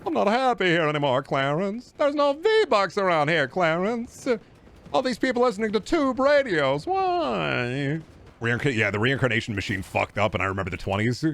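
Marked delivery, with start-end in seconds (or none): 0.1-8.0 high, drawn-out pleading impression; hammed-up anguish, stretched vowels; Jimmy Stewart. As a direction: wail it like an old movie hero. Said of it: "I could have been Jimmy Stewart".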